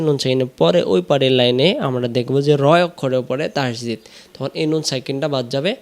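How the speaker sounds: normal talking voice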